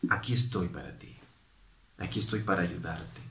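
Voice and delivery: low, soft and caring; gentle reassurance. Retold or imitated. The kind words to say instead.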